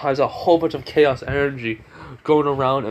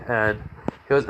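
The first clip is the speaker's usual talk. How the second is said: usual speaking voice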